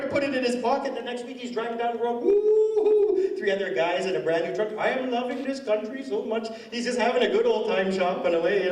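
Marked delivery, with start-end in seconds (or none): 2.2-3.3 high, loud mock whoop of joy. Clerk whooping from his new truck, a loud high mock cheer. 4.8-6.5 higher, mock-gleeful voice of a man living large; gloating clerk. Clerk bragging while shopping on my card, a high gleeful mock voice.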